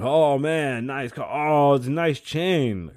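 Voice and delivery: high, loud gushing compliment; mock admirer. Mimicking strangers praising his car and chain, mock gushing. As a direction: gush like an impressed stranger. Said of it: "I pull up with something".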